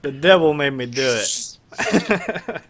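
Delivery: loud, high, drawn-out playful cry; mock outcry. Game master joins the devil joke, loud drawn-out mock cry.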